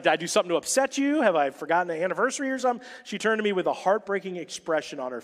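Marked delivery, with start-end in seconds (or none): 0.0-2.9 anxious rush. Retold or imitated. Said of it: "Finally, I was like".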